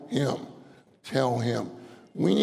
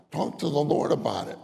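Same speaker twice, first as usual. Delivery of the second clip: ordinary delivery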